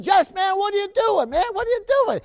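astonished father, pitch flying up; a dad amazed by his son's waiter costume; surprised dad. Retold or imitated. Father surprised at second son.

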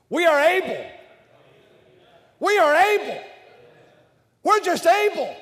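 loud, high proclamation; emphatic declaration. Shown as a demonstration. Preacher leading the crowd, loud and high. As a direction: proclaim it with full conviction. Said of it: "I want you to read this next statement".

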